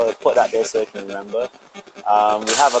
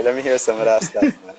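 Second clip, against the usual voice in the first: usual speaking voice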